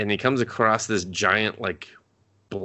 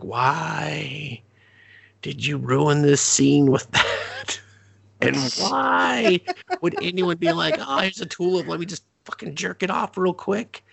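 higher, mock-baffled, disbelieving; incredulous; 8 semitones higher than usual. Fan baffled at the tulip scene, high and incredulous. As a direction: whine it in disbelief, slowly at first.

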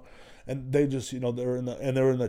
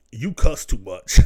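slow quoted scolding; 10 dB louder, slower than usual. Retold or imitated. Quoting friend's complaint.

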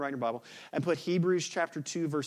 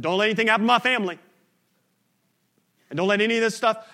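higher, louder and fast, urgent haggling; frantic bargaining; 7 semitones higher, 11 dB louder, much faster than usual. Retold or imitated. Worshipper bargaining with God for family safety, frantic fast plea. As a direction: rush the deal out anxiously.

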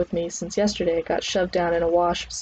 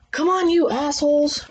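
high-pitched drill yell; loud trainer's yell; 9 semitones higher than usual. Acted. Trainer rousing a lagging class, high yelled call. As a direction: yell it across the gym. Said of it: "Trainer's voice hurt my ears".

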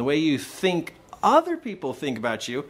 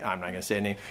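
quick aside; 8 semitones lower, 7 dB quieter, much faster than usual. Real, not acted. Aside while setting up the acted call.

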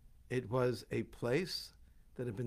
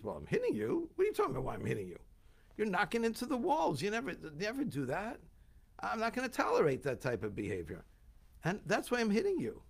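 exasperated scolding; 7 semitones higher, faster than usual. Acted. Bilam yelling at his donkey, indignant and exasperated. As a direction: chew out the donkey, fed up.

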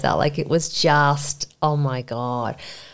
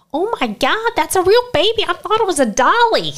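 high, gushing shop-lady voice, much higher than her own; shop woman's voice; 13 semitones higher, 5 dB louder than usual. Retold or imitated. A Hawaii shop assistant mistaking the baby for a doll, high and gushing. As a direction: play the astonished shop assistant cooing over the baby. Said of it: "And the woman's like".

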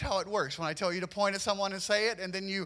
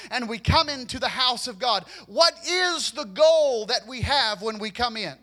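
ordinary talk, nothing marked

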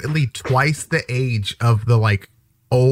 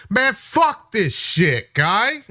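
high, drawn-out put-on local voice; slow, exasperated mock griping; Boston janitor; 9 semitones higher, much slower than usual. Boston janitor griping to his coworker, exasperated.

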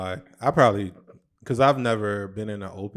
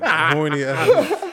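laughing; 6 semitones higher, 6 dB louder, much slower than usual. Real, not acted. Teasing friend while laughing.

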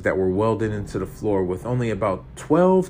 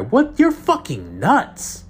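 high, slow, disbelieving scorn; incredulous; 11 semitones higher, 3 dB louder, slower than usual. Acted. Terry scoffs at Boston's plan, disbelieving. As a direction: draw it out, appalled.

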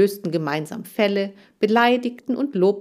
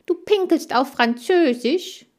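surprised; 9 semitones higher, 4 dB louder than usual. Acted. Chan baffled by French word.

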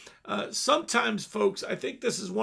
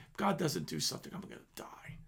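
whispered confession; 4 semitones lower, 9 dB quieter, faster than usual. Retold or imitated. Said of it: "he'd say to me".

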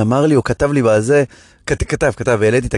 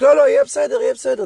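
mock shout; 10 semitones higher, faster than usual. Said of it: "רציתי כזה לצעוק".

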